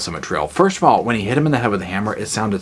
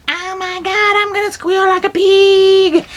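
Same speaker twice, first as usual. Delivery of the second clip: very high, loud, slow whine; panicked whine; 5 dB louder than usual. Retold or imitated. Franklin grabbed by the hitchhiker, high panicked whine. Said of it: "Franklin's like".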